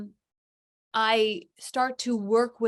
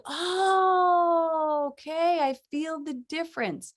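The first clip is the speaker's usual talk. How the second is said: delighted realization; 8 semitones higher, much slower than usual. Retold or imitated. Realizing the difference while giving.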